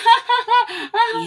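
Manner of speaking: high loud mocking cackle; mocking laugh. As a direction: laugh him to scorn, shrill and loud. Mourners laugh.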